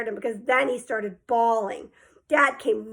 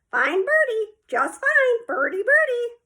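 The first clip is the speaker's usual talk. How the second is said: high squawky parrot imitation; sing-song bird voice, high up; 11 semitones higher, 4 dB louder than usual. A narrator's reading. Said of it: "Fudge laughed".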